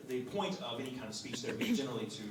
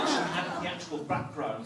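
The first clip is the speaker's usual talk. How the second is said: ordinary talk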